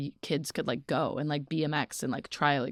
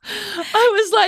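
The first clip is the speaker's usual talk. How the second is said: high, crying with laughter; laughing; 6 dB louder, slower than usual. Real, not acted. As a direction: squeal through the laugh. Outraged laughing retelling.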